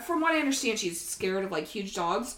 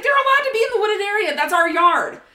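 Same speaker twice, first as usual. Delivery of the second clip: high, loud, fast protest; high, loud, fast protest to the dog warden; indignant protest; 8 semitones higher, 9 dB louder than usual. Retold or imitated. Retold protest.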